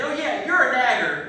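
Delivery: loud slow laughing jab; laughing at his own insult; smug comeback. Retold or imitated. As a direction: fire it back slow and smug. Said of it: "I said".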